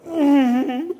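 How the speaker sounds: slow, high mock-child whine; imitated child. Child admitting need for timeout, slow mock whine. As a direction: draw it out like a kid caught out.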